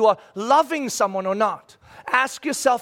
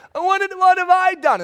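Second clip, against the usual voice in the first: put-on whiny child protest; 12 semitones higher, 6 dB louder than usual. Child protesting to dad about being sent away, high whine. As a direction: play the kid who feels punished unfairly. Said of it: "And I'll hear it".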